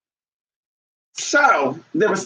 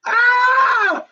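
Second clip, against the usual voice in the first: surprised yelp; 3 dB louder than usual. Real, not acted. Sudden high exclamation.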